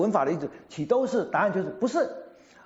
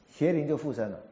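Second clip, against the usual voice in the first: normal talking voice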